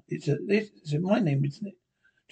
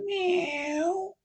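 much higher, slow drawn-out meow; cat meow; 13 semitones higher, 5 dB quieter than usual. Acted. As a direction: mew like a cat. Linda turning into a cat.

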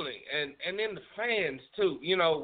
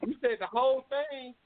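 normal talking voice

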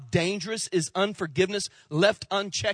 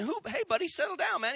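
higher, soothing doctor voice; doctor calming a frantic patient, pitched up; 12 semitones higher, faster than usual. Retold or imitated. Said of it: "the doc says".

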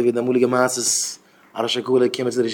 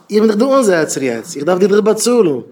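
raised, insistent voice, mildly acted; agitated retelling; 6 semitones higher, 5 dB louder than usual. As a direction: press the point, higher and louder. Acting out argument over paying. (אויפגערעגט נאכגעמאכט)

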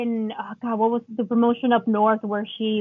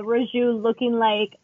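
ordinary delivery

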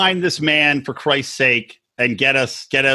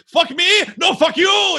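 mock shouting cameraman; 14 semitones higher than usual. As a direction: yell it back high like an insulted cameraman. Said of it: "you can see him being like".

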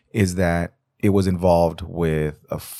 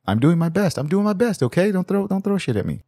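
mock plea; 10 semitones higher than usual. Pleading apology to German listeners.

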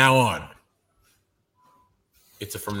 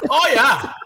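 high goofy catchphrase through laughter; laughing mock cheer; imitating Tony; 9 semitones higher, 4 dB louder than usual. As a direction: mimic a friend's odd accent, pitched up. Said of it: "saying it in his voice".

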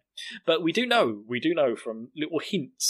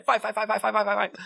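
mock self-psyching chant, pitched up; rapid-fire hyped mutterings, higher voice; Eren; 8 semitones higher than usual. Eren hyping himself in the mirror, imitated urgent chant. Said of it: "Erin's shouting himself in the mirror".

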